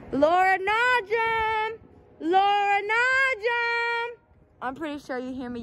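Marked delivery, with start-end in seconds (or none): 0.1-1.8 drawn-out, higher call across the lot; slow hollering of her name. Acted. Calling out for girlfriend outside. 2.2-4.2 much higher, very slow drawn-out holler; sing-song yell of the full name; long call out. Acted. Calling out again, more drawn out.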